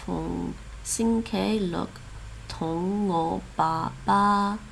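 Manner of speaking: ordinary delivery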